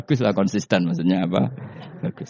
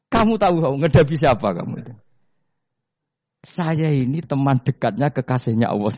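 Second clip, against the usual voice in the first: mock challenge; 5 semitones higher than usual. Umar confronts Munkar Nakir.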